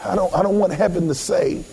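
usual speaking voice

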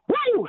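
a sudden high whoop of joy; cheering whoop. Real, not acted. Fan cheering the Super Bowl trip, a high joyful whoop.

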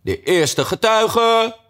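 high, drawn-out herald's cry; loud call. A narrator's reading. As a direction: stretch it out like a court crier. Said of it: "riep luid".

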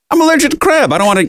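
high, sharp defensive complaint; exasperated protest. Real, not acted. Telling a friend about the rude diner, voice jumps high in exasperation. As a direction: protest it, squeaking with disbelief.

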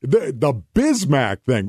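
ordinary talk, nothing marked

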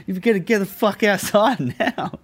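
high, loud mock order broken by laughter; imitated guard's order. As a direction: act out a bossy guard, half laughing. Retelling guard ordering him out, laughing.

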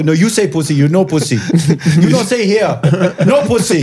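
put-on high, indignant Indian-accented scolding; imitated angry protest. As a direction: mimic the takeaway man getting angry. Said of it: "he starts getting really angry".

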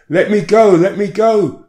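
high, loud, desperate yell of a struggling woman; a woman yelling at the police to let her go; retold yell. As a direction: shout to be let go, pulling away. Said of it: "she yelled".

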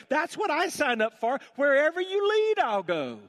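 put-on high, loud, impassioned young seminarian; fervent inner protest. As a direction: cry out your devotion to God.

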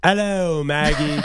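high, hammed-up old professor greeting; a lightly voiced crusty old professor saying hello; bad impression of a salty old professor. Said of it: "an old salty professor".

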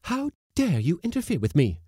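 high, loud outraged scolding; indignant woman's shrill outburst; hot indignation. A narrator's reading. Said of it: "said Missus Blossom hotly".

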